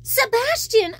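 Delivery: high, startled call of his name; surprised cry. Acted. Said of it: "I cried".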